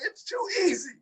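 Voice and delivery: very high, squeaky-pitched retold quip; hammed-up gloating. Telling co-hosts his reply, exaggerated high-pitched gloat. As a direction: throw the voice way up in mock triumph. Quoting own gleeful reply to friend.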